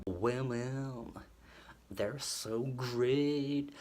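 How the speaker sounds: slow, sappy mock adoration; mock swooning. Reviewer parodying the cheesy song lyric, slow and sappy. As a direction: drawl "Women" with a falling, sappy tune. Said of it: "mocking the song's lyrics".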